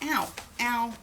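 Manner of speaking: pained ow from stomach cramps. Real, not acted. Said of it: "My stomach hurts".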